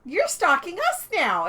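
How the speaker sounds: high, loud, slow playful charge; mock accusation. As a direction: tease her for following you. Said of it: "And we're like".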